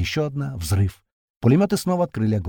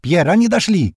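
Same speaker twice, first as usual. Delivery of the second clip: loud, higher call into the radio; shouting into radio; 7 semitones higher, 7 dB louder than usual. A narrator's reading. Girl shouts to Pierre over the radio, loud. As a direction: report it at full voice. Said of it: "кричит в рацию эта девушка".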